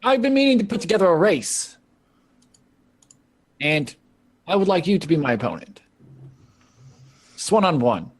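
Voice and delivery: Molly's challenge. Acted. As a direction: lay down the challenge coolly. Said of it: "she goes".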